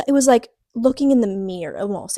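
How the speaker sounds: normal talking voice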